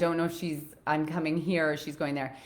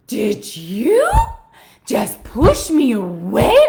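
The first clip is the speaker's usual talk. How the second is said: loud, slow, stunned voice rising on push; mock outraged gasp of a man who can't believe it; shocked disbelief; 5 semitones higher, 12 dB louder, slower than usual. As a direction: play the client, stunned and offended. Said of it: "Just like that".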